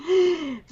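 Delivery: sharp fake gasp of shock; mock gasp. Mocking Muslims running to police.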